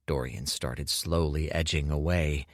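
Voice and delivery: plain reading voice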